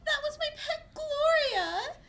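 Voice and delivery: crying panic. Acted. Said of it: "bursts into tears in a full panic".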